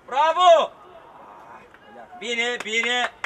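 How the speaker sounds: very loud cheer, easing slightly; praising shout. Real, not acted. Coach praising the play, loud shout. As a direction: shout approval across the pitch.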